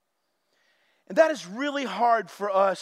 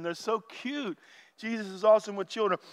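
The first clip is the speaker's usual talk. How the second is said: ordinary talk, nothing marked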